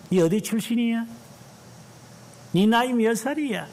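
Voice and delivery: high mocking interrogation; mock barracks bully, pitched high; petty bully. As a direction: ape a small-minded bully grilling a recruit. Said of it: "이런 거 물어보는 놈들".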